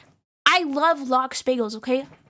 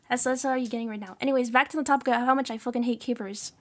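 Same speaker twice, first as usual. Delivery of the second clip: normal talking voice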